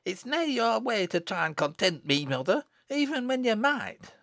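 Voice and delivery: put-on very high, needling little girl; retorting child. Little Jennet answering back to mother, high retort.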